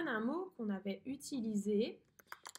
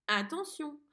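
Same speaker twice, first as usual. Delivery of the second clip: ordinary delivery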